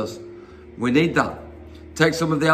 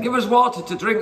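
higher-pitched mock quarrelsome demand; grumbling demand; 7 semitones higher than usual. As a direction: act out a grumbling crowd, pitched up. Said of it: "quarreled and grumbled".

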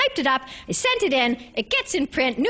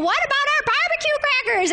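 put-on high little-kid voice; high child's voice, pestering mom about crackers; whiny five-year-old; 11 semitones higher than usual. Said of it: "your five-year-old is saying".

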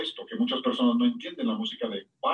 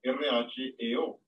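spelled out slowly; much slower than usual. Shown as a demonstration. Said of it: "la palabra griega reo".

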